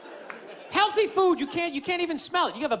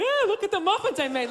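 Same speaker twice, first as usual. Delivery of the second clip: smug muffin lady; 6 semitones higher than usual. Retold or imitated. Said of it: "big stupid look".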